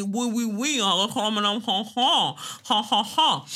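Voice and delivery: hammed-up French oui-oui with honking laughs; mock French accent. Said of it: "Everyone loves a French accent".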